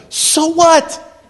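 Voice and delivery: high, incredulous comeback; challenging retort. Acted. Preacher answering "I don't feel like it", challenging retort. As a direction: throw it back at the excuse.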